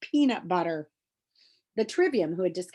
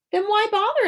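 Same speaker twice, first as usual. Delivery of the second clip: much higher and louder, a sharp alarmed cry; alarmed question; 13 semitones higher, 9 dB louder than usual. Acted. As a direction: fire the question off in a high alarmed pitch. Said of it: "asked Hawk".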